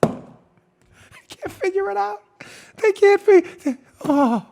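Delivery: real high squeaky laughing speech; cracking up. Real, not acted. Host unable to finish his own joke, high laughing voice. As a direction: try to talk through a laughing fit.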